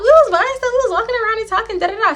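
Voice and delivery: put-on high, light giggly girl voice; giggly friend. Friend giggling her excuse to the narrator, high and playful. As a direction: play the grinning friend, high and bright. Friend laughing and grinning explains.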